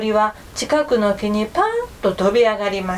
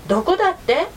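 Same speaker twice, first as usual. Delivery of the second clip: puzzled question; 8 semitones higher than usual. A narrator's reading. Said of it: "もう一度聞きました".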